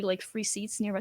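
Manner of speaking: usual speaking voice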